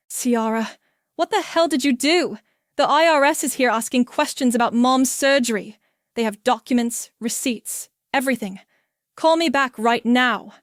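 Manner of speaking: husband yelling into a voicemail; angry voicemail. Acted. Chris demanding a call back from Ciara, loud and angry. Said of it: "voicemail from Chris".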